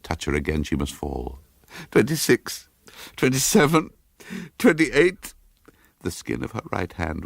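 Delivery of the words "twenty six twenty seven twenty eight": building, higher and louder with each number; panicked counting. Acted. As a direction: count faster into rising panic, as bats brush past. Bats around her, counting in panic.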